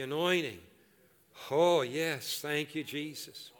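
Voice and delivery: loud, impassioned praying; fervent prayer. Real, not acted. Pastor praying aloud over the congregation, fervent. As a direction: cry out to God to receive. Leads prayer at full force.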